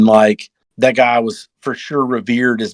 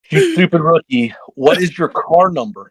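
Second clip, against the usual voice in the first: higher, deliberately spaced-out question; retold exasperation; 6 semitones higher than usual. Team boss to a confused rookie, exasperated. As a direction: spell out "what is your car number" impatiently.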